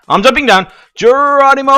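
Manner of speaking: loud, high holler on the leap; gung-ho battle cry. Pretend. Gamer leaping into his maze, gung-ho yell.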